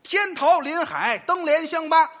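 repeating it back. Shown as a demonstration. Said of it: "哪八个字？".

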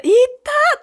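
high, loud "いったー"; exaggerated pain cry after a fall; loud mock ouch. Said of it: "って大きな声で言うかも".

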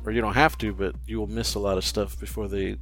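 usual speaking voice

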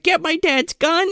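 high urgent voice; mock urgent girl. Girl in the truck yelling to Nick, high and urgent. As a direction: bark it like she's panicking. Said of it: "she's like".

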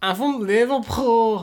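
slow, mock Liverpool accent; a hint of a Liverpool Scouser; mock Scouse accent. Cohost doing a slow mock Scouse accent. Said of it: "making fun of people".

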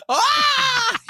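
high loud shriek. Real, not acted. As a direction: let out a piercing scream. Shrieking at shot.

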